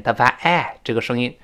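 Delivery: ordinary delivery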